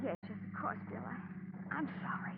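hushed and scared; frightened whisper. Acted. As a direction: shrink away in a fearful whisper. Frightened bride cowed after his outburst.

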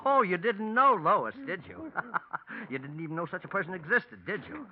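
high, cruel laughing taunt; gloating laugh. Acted. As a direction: laugh at her as you gloat. Killer gloating over his victim.

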